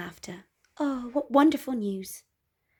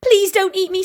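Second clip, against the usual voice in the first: higher, louder frightened plea; pleading; 11 semitones higher, 10 dB louder than usual. Acted. Gertie begs the roaring wolf, high pleading. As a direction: beg the wolf, a touch scared.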